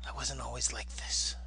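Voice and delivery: hushed whispered movie quote. Retold or imitated. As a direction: breathe it out in a whisper. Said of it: "She says".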